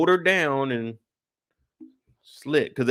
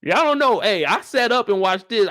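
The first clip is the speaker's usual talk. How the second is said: higher, louder hyped outburst; excited shout; 5 semitones higher, 4 dB louder than usual. Real, not acted. Recapping the episode to viewers, excited outburst. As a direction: burst out, you can't believe it.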